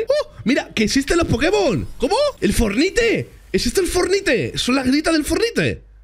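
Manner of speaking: high-pitched excited amazement; asombro entusiasmado. Real, not acted. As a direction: exclaim it high, stretching the words. Amazed at what appears in game. (asombro agudo y alborotado)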